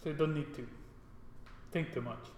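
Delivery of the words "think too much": usual speaking voice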